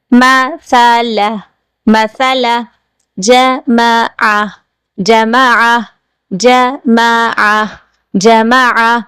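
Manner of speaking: each word once slowly, once at normal pace; slow then normal drills. Shown as a demonstration. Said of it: "practice کی طرف آئیں گے".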